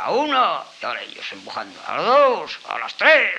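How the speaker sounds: counting the heave. Acted. Fox counting the animals as they lever the rock, loud calls. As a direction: call the heave like leading a team lift.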